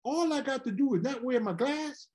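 dad surprised at being paid for eye surgery; amazed dad. Retold or imitated. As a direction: ask it in wonder. Father voiced in the story.

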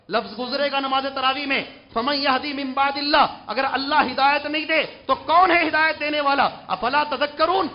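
forceful preaching. Acted. Preacher expounding a verse to the congregation, forceful preaching. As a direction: drive each phrase home from the pulpit.

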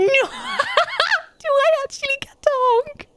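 high, laughing with delight; giggling disbelief, very high; excited laughing. Real, not acted.

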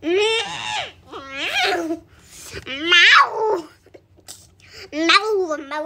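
drawn-out pretend meows; playful cat meowing. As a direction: meow like a kitten, stretched out. Playing a cat, looking for kitty.

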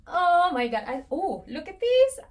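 high, loud gasp of delight; breathless thrill at a gift; excited squeal. Real, not acted. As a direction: squeal over the new bag. Unboxing a birthday present bag.

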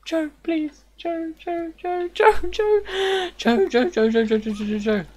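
high, pleading, repeated name; desperate pleading. Real, not acted. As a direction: beg him to swim back. Fox drowning, pleading.

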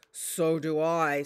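calm big wolf agreeing. Acted. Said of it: "said Big Wolf".

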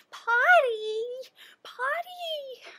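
high, drawn-out mock scream of a small child. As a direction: holler it high like a kid in church. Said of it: "just starts screaming".

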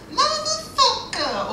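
high, slow drawn-out American curse; American accent. Retold or imitated. Comic doing an American swearing, slow and high. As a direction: stretch it out like a big American. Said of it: "an American can say".

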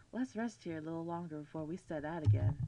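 a hint of a man's voice, gently asking; attempted manly voice. Player reading game dialogue to Eve, half-hearted manly voice. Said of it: "I can't do my manly voice".

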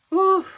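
high, loud breathless exhale; loud high whew. Real, not acted. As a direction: let out a big whew. Overwhelmed by gorgeous gift.

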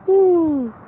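a high, loud laughing woo; high laughing woo after the rant; laughing whoop. Real, not acted. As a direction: let a laugh burst out into a whoop.

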